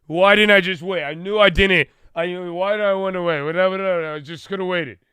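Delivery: spiral over not waiting; regretful whine. Retold or imitated. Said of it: "you're like".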